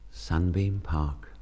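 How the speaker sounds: tender poem reading. Real, not acted. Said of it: "come a little nearer the microphone".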